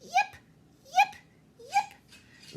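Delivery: very high, loud little yips; puppy yips. Acted. Poodle puppies practising their dainty yips, very high. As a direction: yip like a tiny poodle pup.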